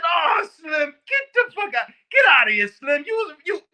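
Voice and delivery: high, loud mock yell of disbelief; disbelieving shout. Inmates yelling in disbelief at a January sixth guy, loud mock yell. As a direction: shout it like you can't believe him.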